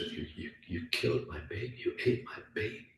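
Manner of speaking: hushed, nearly whispered mock accusation; shocked accusation. Master to the dog he thinks killed his baby, hushed shock. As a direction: stammer it out under the breath, acting appalled.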